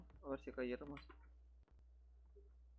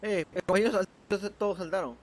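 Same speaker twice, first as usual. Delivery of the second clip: shouting; 6 semitones higher, 10 dB louder than usual. Real, not acted. Calling teammates in game.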